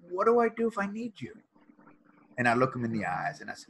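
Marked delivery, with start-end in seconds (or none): 0.1-1.3 son's worried question. Retold or imitated. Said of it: "then he says".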